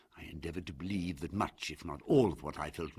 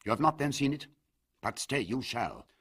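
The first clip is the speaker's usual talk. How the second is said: louder, higher and abrupt; urgent, abrupt insistence; Usher, abrupt and loud; 5 semitones higher, 6 dB louder than usual. A narrator's reading. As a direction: burst out with it, then command. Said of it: "he said abruptly".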